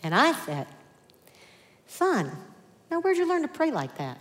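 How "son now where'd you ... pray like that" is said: higher-pitched dad asking his son; lightly acted surprised dad; questioning father. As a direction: ask your little boy in wonder, pitch lifted then falling. Father amazed by praying son.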